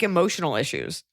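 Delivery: ordinary talk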